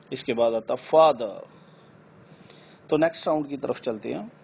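ordinary talk, nothing marked

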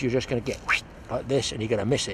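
normal talking voice

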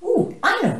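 high, loud eager burst. Acted. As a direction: blurt it out, thrilled. Warthog eagerly has an answer.

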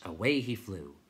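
ordinary delivery, nothing marked

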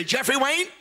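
scolding mother. Retold or imitated. Preacher imitating his mother scolding him, exasperated. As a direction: imitate a mother demanding attention. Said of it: "And she'd say".

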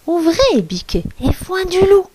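small, high voice of a wolf pretending; dainty fake goat voice; tiny little voice. A narrator's reading. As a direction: make your voice as small and high as possible. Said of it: "sa plus petite voix".

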